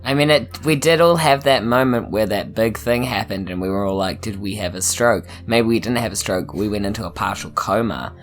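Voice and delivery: normal talking voice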